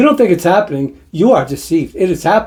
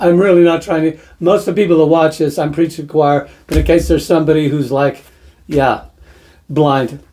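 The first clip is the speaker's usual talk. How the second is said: normal talking voice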